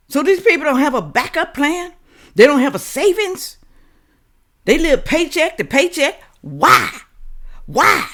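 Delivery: high, loud, incredulous demands; heated lecturing rant; exasperated rant. Real, not acted. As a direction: hammer the viewers with why. Ranting at viewers about savings.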